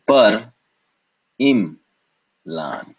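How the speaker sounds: "par, im, lan" as separate beats; alternative syllable split. Shown as a demonstration. Teacher showing another split, "par, im, lan" syllable by syllable. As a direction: pause after "par" and "im". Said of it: "we could have separated this again".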